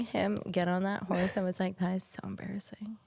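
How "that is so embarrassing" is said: hushed, breathy retold judgement; embarrassed undertone. Fiancée watching him get on the horse, embarrassed undertone. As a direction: say it under your breath, nearly whispering.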